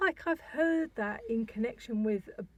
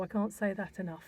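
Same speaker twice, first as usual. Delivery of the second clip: whispering; 4 semitones lower, 6 dB quieter than usual. Real, not acted. Stressing a strict warning.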